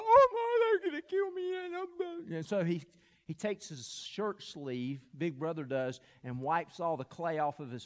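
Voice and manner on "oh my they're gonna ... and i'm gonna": very high, loud imitation of a terrified little boy; mock crying wail. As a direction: wail in exaggerated panic, voice shooting way up. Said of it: "he is crying".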